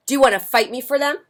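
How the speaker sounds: fast, high, loud taunt; grouchy ladybug challenge. Acted. Grouchy ladybug challenging the friendly one, loud and fast. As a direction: pick a fight, quick and cocky.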